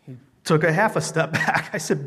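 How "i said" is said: ordinary talk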